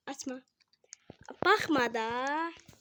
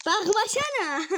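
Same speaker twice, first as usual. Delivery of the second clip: higher, slow teasing whine; higher, slower teasing drawn-out plea; taunting; 7 semitones higher, 3 dB louder than usual. Real, not acted. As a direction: drag it out to needle him. Taunting friend not to look.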